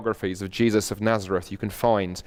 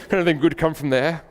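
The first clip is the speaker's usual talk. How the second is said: put-on higher-pitched, snobbish Oxford accent; Oxford British accent; 6 semitones higher than usual. Preacher mimics snobbish Nathanael for laughs, hammed-up Oxford accent. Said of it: "It's an Oxford accent for you".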